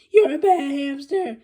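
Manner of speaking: loud wailing cry at the hamster. Acted. Melvin crying at the hamster, loud and wailing. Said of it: "Melvin cried".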